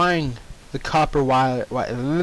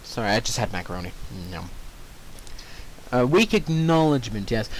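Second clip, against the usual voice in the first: plain reading voice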